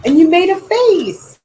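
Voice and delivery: high, loud delighted exclaim; excited. Real, not acted. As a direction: burst out with delight. Delighted at the finished face.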